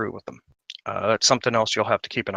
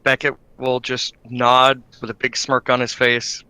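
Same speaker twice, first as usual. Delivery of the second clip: normal talking voice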